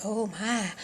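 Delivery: hushed, low aside; imitated whispering. Staff murmur over baby on scale, hushed. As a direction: drop it right down, as if overheard. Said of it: "I could hear 'em whispering".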